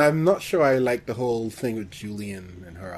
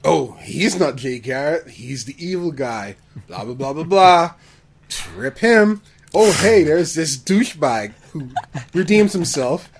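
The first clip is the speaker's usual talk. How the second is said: sarcastic mock-excited retelling; 3 semitones higher, 7 dB louder than usual. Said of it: "I'm like".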